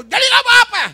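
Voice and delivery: very high, loud shriek; mock wife shout. Wife shrieking that it's fine, mock shout. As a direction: screech it like a shrill wife.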